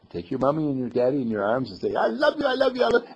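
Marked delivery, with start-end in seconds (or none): 1.9-3.1 put-on high, squealed little-kid love; gushing child affection. Child hugs mummy and daddy at bedtime, gushing high. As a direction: gush it out in one eager run.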